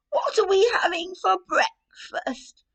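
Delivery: high, slow, singsong granny voice; cheery Granny. Acted. Granny at the door asking about breakfast, high and slow. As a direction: ask it sweetly as the old lady.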